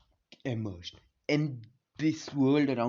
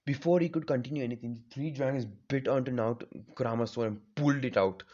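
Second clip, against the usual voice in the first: ordinary delivery, nothing marked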